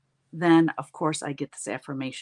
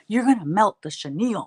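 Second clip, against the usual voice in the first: louder, higher mock alarm; mock alarmed friend; 5 semitones higher, 9 dB louder than usual. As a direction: cry out the warning like a horrified friend. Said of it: "She's like".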